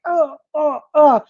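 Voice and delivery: high, exasperated oh oh oh; imitating her own frustrated exclamations; a hammed-up flustered groan of oh; mock angry outburst. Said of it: "And I just go".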